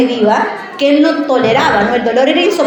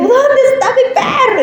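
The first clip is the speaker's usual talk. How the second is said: put-on high crying little-girl voice with a laugh; childish wailing; 13 semitones higher than usual. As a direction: wail it like a heartbroken child. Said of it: "me he puesto a llorar".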